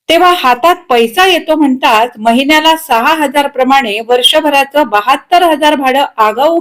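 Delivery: forthright wife, exasperated. Acted. Wife explaining her trusting husband's nature, exasperated. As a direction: explain his ways with weary exasperation.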